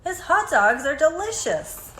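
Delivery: plain reading voice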